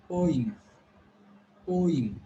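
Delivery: poem, said POH-im with an im ending; correct pronunciation. Shown as a demonstration. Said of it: "this is called as".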